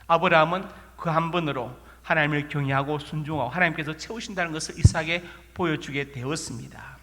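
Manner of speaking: ordinary delivery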